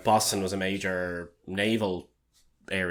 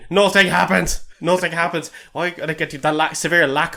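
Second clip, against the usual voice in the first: loud, higher, exasperated complaint; loud rant; 8 semitones higher, 8 dB louder than usual. Real, not acted. Griping loudly to his cohost that nothing happens. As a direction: bellow it like the movie wasted your night. Ranting about bad movie.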